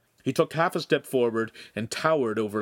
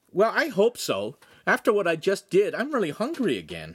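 put-on high-pitched boyish complaint; whining; 9 semitones higher, 3 dB louder than usual. A narrator's reading. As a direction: whine for food, starting high. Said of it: "he whined".

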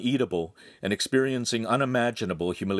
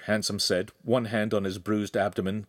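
plain reading voice

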